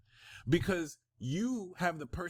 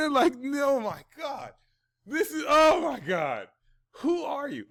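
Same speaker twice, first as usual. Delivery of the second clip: laughing amazement; 3 dB louder than usual. Real, not acted.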